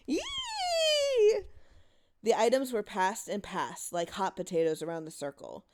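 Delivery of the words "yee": shrill excited squeal, much higher. Acted. Children in the dark squealing with glee. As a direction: squeal with delight.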